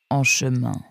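Mirko, voix grave et posée; voix profonde. A narrator's reading. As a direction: annoncer d'une voix profonde. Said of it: "d'une voix profonde". (Mirko répond, voix légèrement plus grave)

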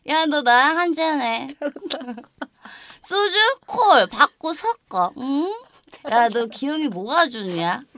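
put-on slurred, slow drunken rambling; drunk acting. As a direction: play it tipsy and maudlin, laughing. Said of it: "나 술 취한 연기할게".

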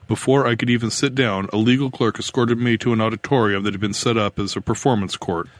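ordinary delivery

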